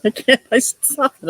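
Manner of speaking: high, breaking up with laughter; real laughter spilling over; laughing. Real, not acted. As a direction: try to talk through giggles. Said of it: "I'm snorting".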